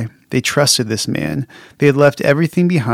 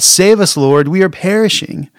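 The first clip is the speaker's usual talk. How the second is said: crying out; 6 semitones higher than usual. Retold or imitated. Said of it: "permission to cry out".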